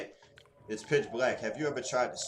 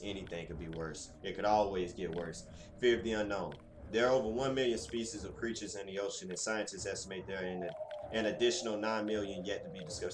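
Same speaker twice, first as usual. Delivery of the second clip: ordinary delivery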